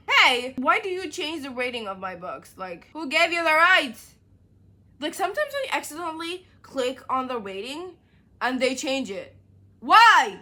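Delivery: shouted complaint at the app; outraged rant. Real, not acted. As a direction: demand answers from the app. Ranting at Goodreads app.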